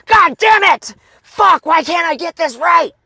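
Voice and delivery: imitating a client's angry shouts; frustrated angry outburst. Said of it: "frustrated and angry like that".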